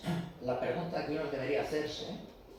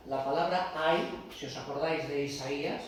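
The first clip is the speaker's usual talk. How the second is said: usual speaking voice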